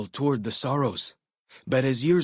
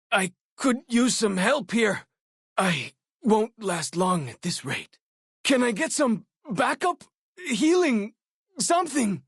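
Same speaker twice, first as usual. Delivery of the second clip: desperate call for help; 10 semitones higher than usual. Acted. Wounded companion begging the player for healing, desperate. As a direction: beg for backup mid-fight.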